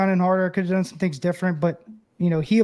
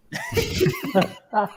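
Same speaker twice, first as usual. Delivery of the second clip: sudden high laugh. Real, not acted. Player laughing at his own joke, sudden high laugh.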